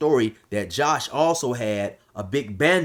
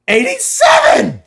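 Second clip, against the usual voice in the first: much higher, loud drawn-out yell; screaming; 12 dB louder, slower than usual. Retold or imitated. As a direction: bellow the number like a taunt. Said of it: "he's saying eighty-eight".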